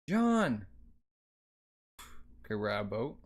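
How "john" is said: high, raised call of a name; sudden high-pitched acted shout; acted alarmed call. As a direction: cry out for your child. Mother calls out to her son.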